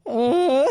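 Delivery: long, loud mock groan; imitated moan. Retelling his noises in bed, exaggerated moan. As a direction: moan it out, hammed up. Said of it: "he's, like, moaning".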